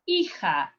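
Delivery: "Hija" with a silent H, as EE-ha; correct Spanish pronunciation. Shown as a demonstration. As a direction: say "Hija" starting straight on the ee vowel. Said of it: "difficult of the pronunciation".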